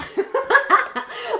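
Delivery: high, loud laugh at herself; bursting laugh. Real, not acted. Laughing to viewers after mimicking her TikTok voice. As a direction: crack up after the bit.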